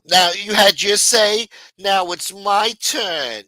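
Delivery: loud, high, drawn-out mocking imitation; mock demanding rival. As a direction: stretch each word, mimicking someone loudly claiming their turn. Mimicking Angel going after Maureen.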